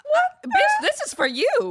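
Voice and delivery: high incredulous outburst; outraged disbelief. Real, not acted. Host reacting to guy's rude "why", high shocked outburst. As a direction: react like you can't believe it.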